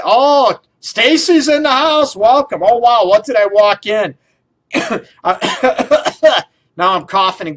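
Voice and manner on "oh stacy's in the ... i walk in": much higher and louder, calling out a happy hello; excited welcome. Real, not acted. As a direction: greet her like a friend just burst in. Host greets caller arriving live.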